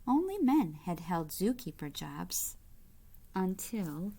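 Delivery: bright, lively storybook reading; reading to children. A narrator's reading. Reading a picture book aloud to kids, lively storybook voice. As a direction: read it out to the little ones with gentle delight.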